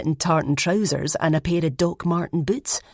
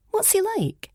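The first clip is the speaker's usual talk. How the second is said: put-on much higher curious girl voice; high character voice. A narrator's reading. Elspeth asking about the boyfriend in a high voice. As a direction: ask it high and eager.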